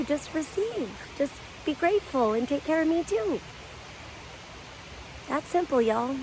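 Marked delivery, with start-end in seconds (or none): 0.0-3.4 soft gentle earth voice; voice of the land. Retold or imitated. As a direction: speak tenderly as the land itself. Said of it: "The land will talk to you".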